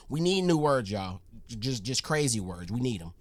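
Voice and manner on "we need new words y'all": normal talking voice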